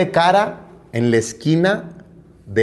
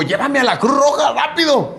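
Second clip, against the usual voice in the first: urgent plea; 10 semitones higher than usual. Retold or imitated. Father urging taxi driver.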